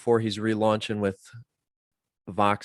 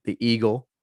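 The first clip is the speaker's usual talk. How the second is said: ordinary delivery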